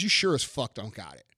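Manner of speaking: ordinary talk, nothing marked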